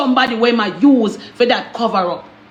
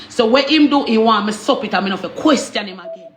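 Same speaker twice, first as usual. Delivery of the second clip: ordinary talk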